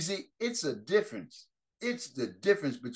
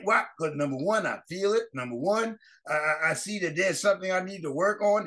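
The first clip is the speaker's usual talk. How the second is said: ordinary talk, nothing marked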